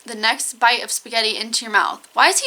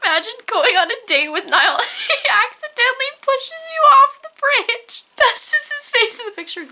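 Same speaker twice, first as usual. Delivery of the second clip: high, squeaky read through laughter; laughing while reading; 11 semitones higher, 3 dB louder than usual. Real, not acted. Reading a silly fan story about [name], laughing. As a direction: struggle to get the words out for laughing.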